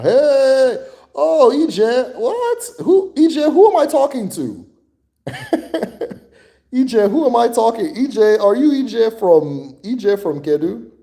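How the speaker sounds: high and loud, delighted to spot a viewer; excited greeting. Real, not acted.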